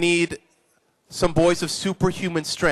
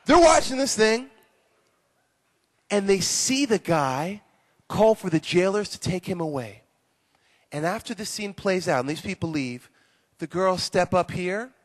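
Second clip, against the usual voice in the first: usual speaking voice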